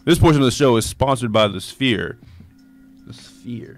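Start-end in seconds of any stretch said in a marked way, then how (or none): 3.1-3.8 breathy stage whisper of the name. Acted. Repeats sponsor name whispered.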